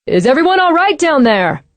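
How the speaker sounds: high call from a distance. Acted. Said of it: "A man's voice called".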